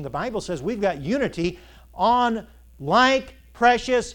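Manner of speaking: loud, high, slow pulpit thunder; preaching at full force. Acted. Preacher to his congregation, building to a loud sermon peak. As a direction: hammer home the point from the pulpit.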